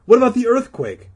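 high, loud, exasperated question; demanding. Acted. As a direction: insist they listen to the alarm. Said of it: "Todd demanded".

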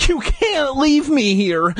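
high, slow desperate shriek. Acted. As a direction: shriek at the window. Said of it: "I shrieked".

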